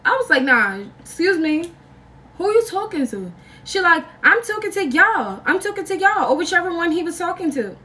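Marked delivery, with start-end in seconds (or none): 0.4-3.3 high-pitched, affronted comeback; indignant challenge. Retold or imitated. Teller challenges the girlfriend, retold. 4.2-6.3 high-pitched, insistent retort; mock defiant comeback. Girlfriend snaps back, retold.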